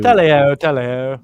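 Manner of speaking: hammed-up upper-class British accent; posh English accent. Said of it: "talking a bit like an English posh person".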